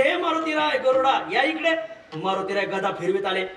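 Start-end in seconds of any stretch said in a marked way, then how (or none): 0.0-1.8 raised high calling voice. Retold or imitated. God calls out to Hanuman and Garuda, raised call. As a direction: summon them from afar, higher.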